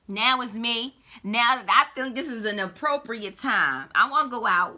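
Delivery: loud party-ready whoop, pitch jumping up; шутливо-разгульный возглас. Acted. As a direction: play at being hyped for a night out. Acting out eagerness to party.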